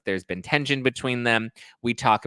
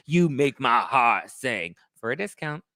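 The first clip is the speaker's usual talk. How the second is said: ordinary talk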